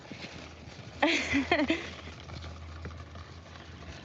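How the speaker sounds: airy giggle under the breath; breathy laugh. Real, not acted. Laughing at the old man.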